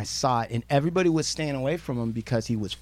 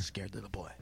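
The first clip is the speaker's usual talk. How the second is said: quiet taunt; 8 semitones lower, 21 dB quieter than usual. Pretend. Hushed aside taunt.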